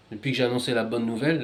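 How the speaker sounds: ordinary talk, nothing marked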